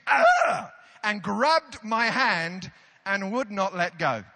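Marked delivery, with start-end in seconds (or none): 0.1-0.7 loud, high comic shriek; mock scream. Woman passenger hitting turbulence again, loud mock scream. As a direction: yelp in mock terror. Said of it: "The woman screamed".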